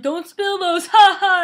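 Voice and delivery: high, loud mocking teacher laugh; teasing teacher. Teacher mocking her about spilled carrots, hammed-up teasing. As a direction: tease like a smug teacher. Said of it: "he'd be like".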